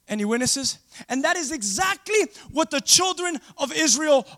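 loud, high, drawn-out preaching cadence; full-force preaching. Acted. Pastor preaching to his church, loud and high. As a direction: preach it to the congregation.

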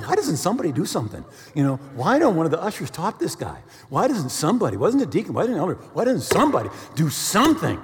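hammed-up pleading inner rant; exasperated. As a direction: pile up the questions in mock desperation. Acts out his exasperated thoughts mid-sermon.

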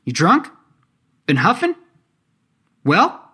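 high, loud questions sweeping sharply upward. A narrator's reading. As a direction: fear and anger in failing bravado. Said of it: "Fear and anger intermixed. She raised her voice".

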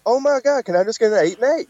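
high-pitched pleading outburst; high start, then a hurried plea; mock exasperated. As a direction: act fed up with a simple order going wrong. Acts out exasperated plea to cashier.

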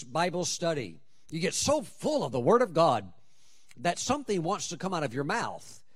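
ordinary delivery

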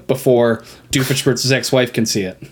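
usual speaking voice

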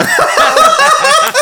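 loud high laugh breaking out; burst of laughter. Real, not acted. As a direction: crack up laughing hard. Player cracks up at nerd shit.

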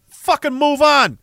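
high, loud and slow; fed-up shout. Real, not acted. Streamer telling a chatter to drop it, shouting. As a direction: bark it out at them. Streamer ranting at a super chat.

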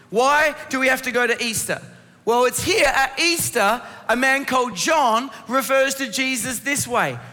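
ordinary delivery

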